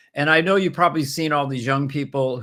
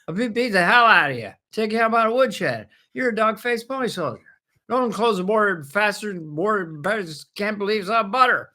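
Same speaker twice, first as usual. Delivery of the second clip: put-on high, blustery old-man politician voice; rambling Biden impression quoted back at him; 8 semitones higher than usual. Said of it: "puts a little reporter in with a mic up going".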